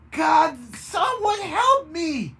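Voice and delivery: loud, high, slow cry for help. A narrator's reading. Baroness cries out for help growing fins. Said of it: "Baroness cried".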